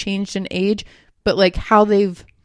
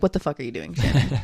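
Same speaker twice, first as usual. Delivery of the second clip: lower, blunt bro-style telling-off; blunt bro talk; 5 semitones lower, faster than usual. Retold or imitated. Angels scolding Shannon bluntly, a lower blunt bro voice. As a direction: growl it low like a buddy calling you out. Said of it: "I like to say bro slang".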